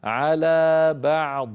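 على بعض slow, the ض made silent with no trailing sound; tajweed stop. Shown as a demonstration. Teacher showing how to stop on على بعض. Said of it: "فننطقها هكذا".

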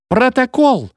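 high-pitched outraged call; indignant yell from a crowd; shouted demand. A narrator's reading. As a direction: cry out the demand. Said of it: "кричали в жёстком вагоне".

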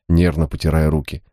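plain reading voice

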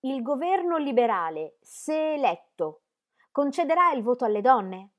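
high, loud, forceful question; loud challenge. A narrator's reading. Christabel repeating her question to the Liberal meeting, loud and high. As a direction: stand up and demand an answer loudly. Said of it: "si alzò in piedi e ripeté la domanda".

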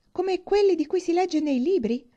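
high, excited question; eager wonder. Acted. Catherine imagining castles from her books, excited wonder. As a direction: ask with wide-eyed eagerness. Heroine thrilled about the castle.

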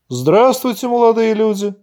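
attempted good-natured greeting. A narrator's reading. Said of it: "попытался добродушно улыбнуться".

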